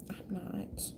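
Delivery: whispered aside; a sly whispered aside. Acted. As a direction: drop to a breathless whisper.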